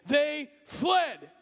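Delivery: loud, high preacher's climax, shouting to the crowd; rally the youth like a revival preacher; preaching at full force. Acted.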